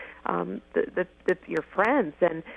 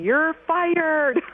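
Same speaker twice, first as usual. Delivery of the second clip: put-on high, loud playful yell of the old firing joke; retold scream; 9 semitones higher, 4 dB louder than usual. Friends calling the new winner, mock-screaming the line. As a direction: shout it down the phone like a prank. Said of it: "and they screamed in the phone".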